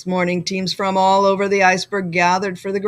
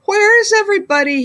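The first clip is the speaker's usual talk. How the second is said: high, fast bewildered call; confused cry; 10 semitones higher, 5 dB louder, faster than usual. Acted. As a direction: blurt it out lost and puzzled. Tacky lost in the drill.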